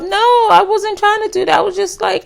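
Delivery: high, breezy mock denial; playing it off. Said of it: "trying to play it off".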